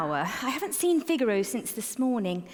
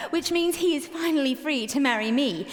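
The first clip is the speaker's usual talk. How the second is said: usual speaking voice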